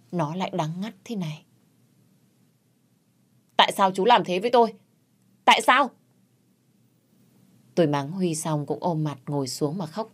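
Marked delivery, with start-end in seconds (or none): 3.6-5.9 raised, fast accusing cry; angry outburst. Acted. Wife confronting her brother-in-law, a fast angry cry. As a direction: hurl the question at him in anguish. Quỳnh confronts Huy, crying.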